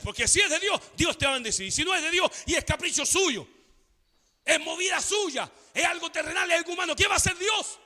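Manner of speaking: high, driving pulpit warning; insistent preaching. Real, not acted. Preaches at full force.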